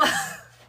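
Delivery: a sudden, very high, loud laugh; high-pitched laugh. Real, not acted. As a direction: burst into a squealing laugh. Laughs at her flubbed line.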